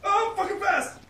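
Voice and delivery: high gleeful yelling; excited yelling. Real, not acted. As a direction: crow about your escape. Gloating after escaping the killer.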